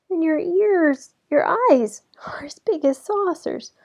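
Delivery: put-on frightened girl whispers; nervous gulp. A narrator's reading.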